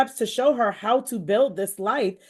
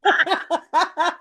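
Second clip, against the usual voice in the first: laughing; 5 semitones higher, 3 dB louder than usual. Real, not acted.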